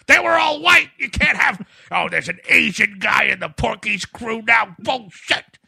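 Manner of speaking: loud, high outraged yelling; angry shout. Retold or imitated. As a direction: rant like an outraged fan. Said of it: "you're getting a lot of emails like".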